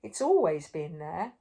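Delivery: plain reading voice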